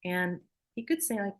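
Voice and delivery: ordinary delivery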